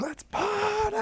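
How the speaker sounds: very high squeaky whoop; cartoon party cry. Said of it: "he's like".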